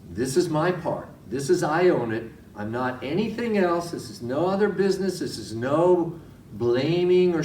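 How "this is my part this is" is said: ordinary talk, nothing marked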